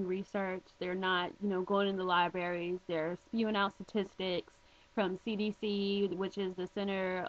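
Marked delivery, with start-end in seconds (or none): none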